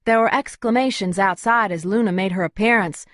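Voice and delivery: ordinary delivery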